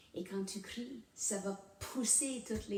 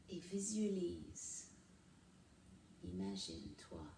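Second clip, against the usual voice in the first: guided meditation; 7 dB quieter than usual. Real, not acted. Host leading viewers through breathing and a mantra, guided meditation. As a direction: ease them into calm, leaving long pauses, softening as you go.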